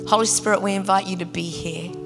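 ordinary talk

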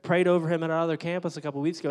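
normal talking voice

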